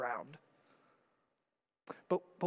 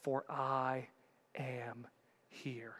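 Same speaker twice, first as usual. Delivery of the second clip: softer, slow and fading; hushed reverence; 2 semitones lower, 5 dB quieter, much slower than usual. Shown as a demonstration. Pastor giving Jesus' promise softly, almost whispered. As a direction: let it fall to a hush. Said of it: "be fearless".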